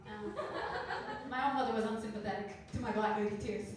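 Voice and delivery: ordinary talk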